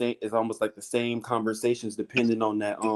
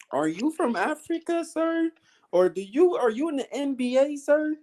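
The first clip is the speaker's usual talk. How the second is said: put-on much higher eager kid voice; high imitation of kids. Local kids asking if he plays in the NBA, high mock voices. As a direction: pipe up like curious children.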